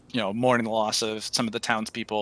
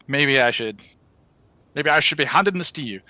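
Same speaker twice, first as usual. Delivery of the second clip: game master voicing the dwarf to Novak in a dwarf accent; 3 semitones higher, 5 dB louder than usual. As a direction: nudge the dwarf accent in, quick. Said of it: "That was a good accent there".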